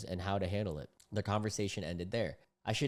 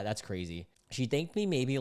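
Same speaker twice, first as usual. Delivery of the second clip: ordinary talk, nothing marked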